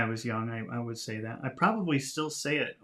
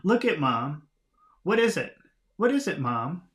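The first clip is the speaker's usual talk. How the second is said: put-on high, insistent kid's questions; acted childlike curiosity, louder on key words; child; 4 semitones higher, 5 dB louder than usual. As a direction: pester Mom like an excited child. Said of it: "especially from children".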